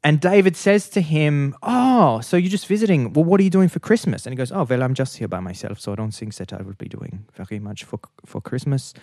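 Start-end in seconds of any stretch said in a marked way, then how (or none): 1.6-4.2 high, lilting "oh" of interest; chatty and curious, pitched up; imitated friendly interest. Uncle asking visitor about Christmas, high friendly interest. Uncle kindly quizzing lonely stranger. 4.5-8.9 put-on softly spoken Austrian accent, tailing away; imitated Austrian accent. Lonely visitor answering about Christmas, soft imitated Austrian accent. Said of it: "I'm Austrian".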